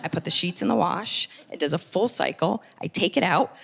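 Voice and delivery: normal talking voice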